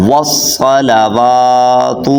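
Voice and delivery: slow tajweed model of والصلوات; correct long waw. Shown as a demonstration. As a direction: chant والصلوات slowly as "was-salawaatu", holding "waa". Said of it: "تو آپ نے کیسے پڑھنا ہے؟".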